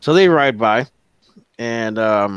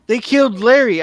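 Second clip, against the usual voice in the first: higher, louder dismayed cry; shocked riders; 11 semitones higher, 6 dB louder than usual. Acted. GM's riders react to Larry's death, dismayed. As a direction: gasp it out in disbelief. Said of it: "They're like".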